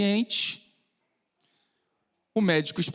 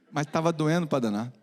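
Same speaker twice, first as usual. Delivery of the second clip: usual speaking voice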